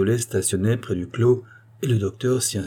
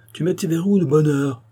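raised, blustering man's voice; gruff big voice; 7 semitones higher, 3 dB louder than usual. A narrator's reading. Visitor questioning the girl about her bolted door, gruff and blustering. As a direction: scold her in a high, heated tone. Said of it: "de sa grosse voix".

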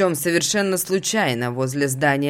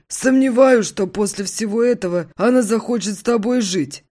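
much higher, loud doubting buddy; friend voice; 7 semitones higher, 3 dB louder than usual. Acted.

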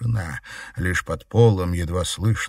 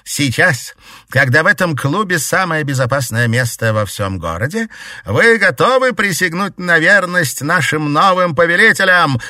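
loud, high rallying cry; exclaiming; 9 semitones higher, 7 dB louder than usual. A narrator's reading. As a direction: rouse the crowd loudly. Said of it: "воскликнула Гертруда".

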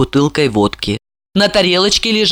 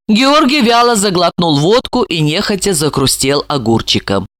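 ordinary delivery

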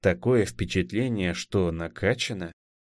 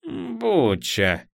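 high squeaky rat trying the word; twitchy squeaking rat voice; 11 semitones higher than usual. Acted. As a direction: squeak the name out like a nervous rat. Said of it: "попробовала на голос крыса".

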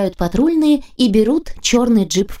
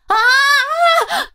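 high piercing terrified scream. Acted. Bride screams as the jeep swerves off road, high piercing shriek. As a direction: shriek in panic as the car lurches.

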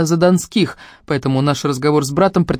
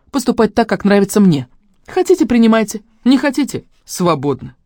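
heated, higher-pitched declaration; defiant outburst; 6 semitones higher, 4 dB louder than usual. A narrator's reading. Daughter tells family she'll live her way, defiant. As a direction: declare independence, take it or leave it.

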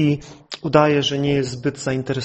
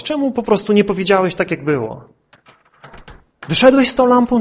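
raised pitch, stammering disbelief; exasperated friend; 5 semitones higher, 3 dB louder than usual. Retold or imitated. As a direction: act out a friend demanding an explanation. Friend confronts the hesitant signalman. (podniesiony, zniecierpliwiony głos przyjaciela)

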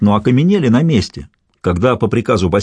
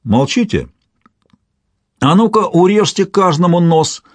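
raised-pitch menacing command; threatening order; 8 semitones higher than usual. A narrator's reading. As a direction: order punishment of silent captives. Commander threatens silent captives. (угрожающий приказ)

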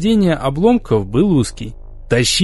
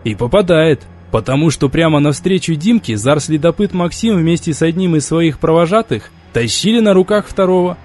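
plain reading voice

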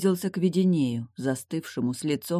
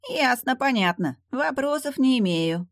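much higher, mock-compliant demon; mock agreeable; 9 semitones higher than usual. A narrator's reading. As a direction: agree with fake meekness. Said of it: "покладисто заявил встречник".

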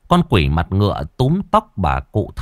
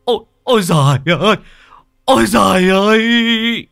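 much higher, louder anguished cry; howling wail; 12 semitones higher, 5 dB louder than usual. Acted. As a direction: wail out in terror. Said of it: "Cụ rú lên". (rú lên kinh hãi)

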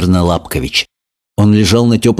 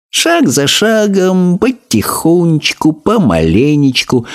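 higher, slow tortoise motto; slow creaky tortoise; 9 semitones higher, slower than usual. A narrator's reading. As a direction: repeat the motto as a habit. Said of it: "привычно повторила Транквилла".

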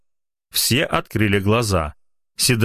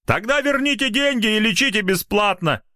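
shouted out; 12 semitones higher than usual. Acted. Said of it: "неожиданно выкрикнул".

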